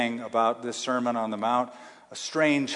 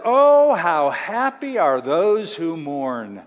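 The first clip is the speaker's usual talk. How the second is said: exclaiming; 4 dB louder, slower than usual. Shown as a demonstration. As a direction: burst out with joy, then linger. Preacher exclaims while reading verses.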